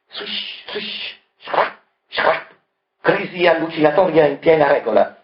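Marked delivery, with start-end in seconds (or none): 0.1-2.5 chalk scratching. A narrator's reading. Chalk noises in narration.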